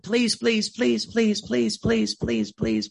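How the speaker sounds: a hint of desperate begging; mock begging. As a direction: imitate people nagging God for answers. Mocking people begging God.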